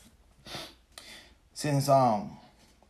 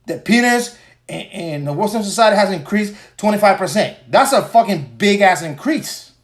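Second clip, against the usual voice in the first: loud, higher, fast defiant rant; heated rant; 6 semitones higher, 8 dB louder than usual. Real, not acted. Host tells listeners who dislike male anatomy to leave, loud defiant rant. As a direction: shout down the critics, fast and fired up. Telling critics to go away.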